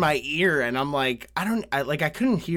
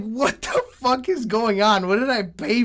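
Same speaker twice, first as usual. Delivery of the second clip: a half-laughing what-the-hell; exasperated disbelief; 6 semitones higher, 4 dB louder than usual. Retold or imitated. To himself under the lap dance, higher exasperated disbelief.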